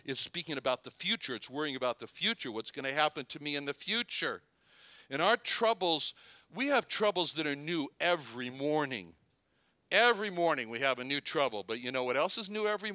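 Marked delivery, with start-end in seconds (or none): none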